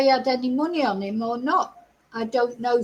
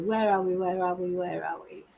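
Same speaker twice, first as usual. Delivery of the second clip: each repeat softer and lower; fading mutter; 6 semitones lower, 7 dB quieter than usual. Real, not acted. Finding her place in the notes, fading lower and softer. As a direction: trail off to yourself, fading. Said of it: "losing her place, muttering".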